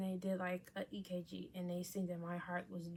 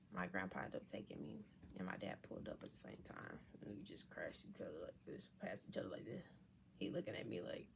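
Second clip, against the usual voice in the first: low unvoiced whisper; much softer hushed narration; 12 semitones lower, 9 dB quieter, faster than usual. Real, not acted. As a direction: whisper the story quietly.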